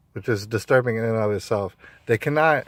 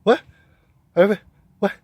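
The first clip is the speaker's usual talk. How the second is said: startled; 8 semitones higher, 6 dB louder, faster than usual. Retold or imitated. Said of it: "They'd be like".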